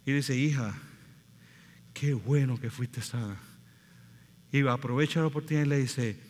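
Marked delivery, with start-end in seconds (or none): 0.4-3.4 tender. Retold or imitated. Jesus speaks to healed woman.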